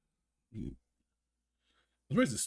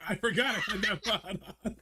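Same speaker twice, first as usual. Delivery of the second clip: high, giggling streamer quote; laughing quote; 10 semitones higher than usual. Retold or imitated. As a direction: say it while cracking up. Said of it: "he was like".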